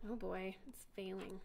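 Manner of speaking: real whisper under the breath; whispered aside. Real, not acted. Teacher watching the demo fail, whispering to herself. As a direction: whisper the worry to yourself. Demo fails, mutters to self.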